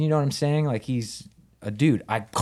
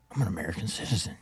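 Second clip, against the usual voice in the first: quick, whispery, secretive thought; hushed realization; 4 semitones lower, 8 dB quieter, faster than usual. Retold or imitated. Thinking up a crazy idea in custody, hushed. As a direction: murmur it to yourself as it dawns.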